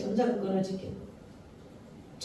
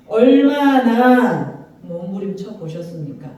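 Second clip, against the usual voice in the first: slow, louder, leaning on 얼마나; pressing question; 4 dB louder, slower than usual. Real, not acted. Preacher pressing the congregation, slow and stressed. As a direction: push 얼마나 hard at the congregation.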